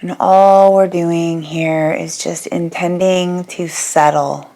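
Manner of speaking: unhurried yoga nidra guidance with long pauses; guided meditation. Real, not acted. Yoga teacher guiding listeners to settle their bodies, calm guided meditation. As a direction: ease them into rest, phrase by phrase, leaving long silences.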